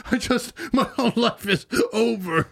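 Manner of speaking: high-pitched mock despair, breaking into a laugh; mock pleading. Abandoned husband tells Carrie his life is over, mock pleading. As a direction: imitate a devastated husband, hammed-up. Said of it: "he's just sitting there pleading".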